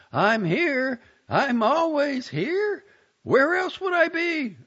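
very high, loud, slow call. A narrator's reading. Wife calling down the stairs to husband, loud high call. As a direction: call up the stairs through cupped hands. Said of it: "cupped her hands to her mouth and called".

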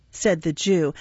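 plain reading voice

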